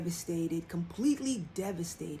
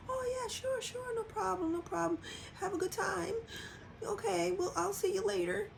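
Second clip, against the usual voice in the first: much higher, softer voice near tears; forced brave sad; 12 semitones higher, 4 dB quieter than usual. Retold or imitated. Telling partner to have fun at bingo while near tears. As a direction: pretend it's fine while choking up. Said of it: "And I would be so sad".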